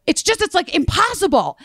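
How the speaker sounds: high, exasperated caller voice; mock a man at the end of his rope; imitated screaming. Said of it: "if someone's screaming at you".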